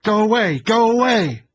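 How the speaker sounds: screaming high and loud, repeated; wild shout. A narrator's reading. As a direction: yell it over and over in panic. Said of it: "she shouted wildly".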